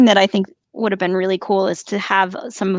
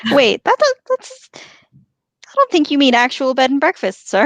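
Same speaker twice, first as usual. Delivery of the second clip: higher, louder hobbit protest; 6 semitones higher, 5 dB louder than usual. Pretend. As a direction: squeak out a confused objection. Playful in-character banter.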